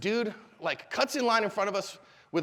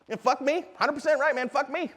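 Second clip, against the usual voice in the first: higher-pitched hearty mock agreement; over-agreeable; 7 semitones higher than usual. Shown as a demonstration. Teacher showing how to agree with an aggressor, over-agreeable. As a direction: concede eagerly, siding against yourself.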